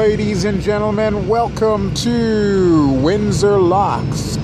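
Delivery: hammed-up flight-deck arrival announcement; mock cabin announcement. Pilot-style arrival announcement.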